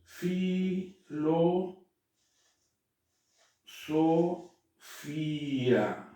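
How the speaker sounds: filosofía stretched very slowly, every syllable held; drawn-out Greek demo. Shown as a demonstration. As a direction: spell out filosofía syllable by syllable, very slow. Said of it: "la palabra".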